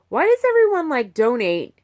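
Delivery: a fed-up rant flaring up; exasperated outburst. Real, not acted. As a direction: burst out in frustration. Ranting about donors.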